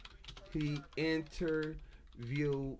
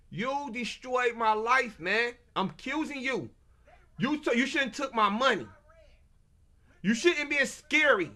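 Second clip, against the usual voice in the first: angry accusation; 6 semitones higher, 4 dB louder than usual. Real, not acted. Directly accusing the attorney.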